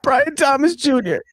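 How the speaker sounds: high-pitched put-on weeping; hammed-up tearful whine; fake crying. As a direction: force out the tears while asking. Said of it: "He's fake crying until he actually starts".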